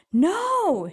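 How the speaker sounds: high, sharp shouted no. Acted. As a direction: stop him with a shout. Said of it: "shouted Bartholomew".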